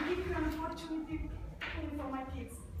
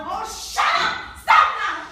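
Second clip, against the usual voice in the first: shouting back; 3 semitones higher, 16 dB louder, slower than usual. Acted. Mother shouts back at husband.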